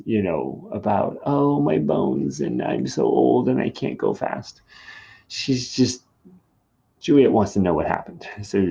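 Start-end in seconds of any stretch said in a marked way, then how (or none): 1.2-4.6 slow, higher, louder moan; complaining old nurse. Acted. Nurse grumbling that she is too old, complaining. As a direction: groan about your aching old bones. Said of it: "so she complains".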